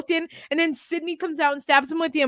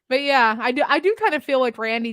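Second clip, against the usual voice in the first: normal talking voice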